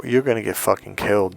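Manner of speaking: ordinary delivery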